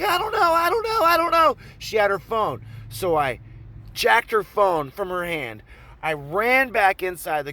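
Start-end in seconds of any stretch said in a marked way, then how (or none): 0.0-1.6 very high, squealed imitation; mock panicked girl. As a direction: wail it like a cornered girl. Panicked girl's reply, imitated.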